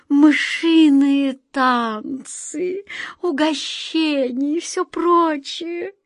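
slow, high, drawn-out relish; grandly enticing. A narrator's reading. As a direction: stretch each treat out temptingly, pitch lifted. Queen boasts of her ball.

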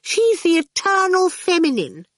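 very high, lofty reproof; reprovingly. A narrator's reading. Miss Potter praises Dillion to a rude child, reprovingly. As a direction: admonish in a high, admiring gush. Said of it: "Miss Potter said reprovingly".

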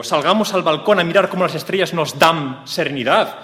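high, loud overacted romance; loud melodramatic plea. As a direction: declaim it like a soap-opera lover. Acted romantic dialogue.

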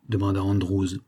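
ordinary delivery

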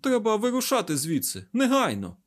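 high, pressing, urgent order; worried urgency. A narrator's reading. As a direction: urge them anxiously to leave. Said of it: "промовив він стурбовано".

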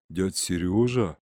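low, slow, stunned recognition. A narrator's reading. Boy recognizing uncle he thought lost, stunned. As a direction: say the name slowly, low, in disbelief.

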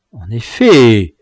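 slow, contained anger; concentrated rage. A narrator's reading. Dwarf answering his rival, concentrated rage. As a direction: hold the rage in. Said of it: "avec une rage concentrée".

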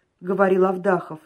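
plain reading voice

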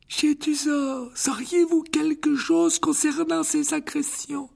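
put-on high, slow frail old wizard; old headmaster voice. A narrator's reading. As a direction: greet him like a frail elderly headmaster. (voix aiguë et lente de vieux directeur)

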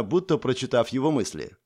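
ordinary delivery, nothing marked

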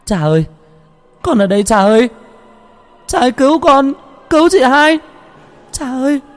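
very high, loud cries for help; desperate screaming. A narrator's reading. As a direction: scream for your father to come save you. Child screams for father's rescue. (kêu cứu thất thanh)

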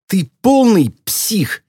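high, slow, emphatic insult; indignant outburst. A narrator's reading. Girl berates her driving teacher, indignant. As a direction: stretch it out in outrage. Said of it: "недовольная собеседница".

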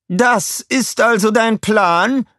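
high, slow, mocking villain voice; villain calling out. A narrator's reading. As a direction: taunt him from above. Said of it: "rief sie".

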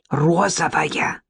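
slow stage whisper; loud whisper. A narrator's reading. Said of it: "громким шёпотом пояснил".

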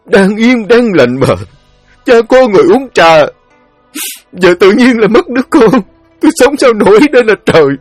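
a father keening over his dead son; wailing grief. Acted. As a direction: cry out to heaven in despair.

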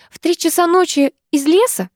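high, sceptical questioning. A narrator's reading. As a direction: repeat it as if disbelieving. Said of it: "со скепсисом в голосе".

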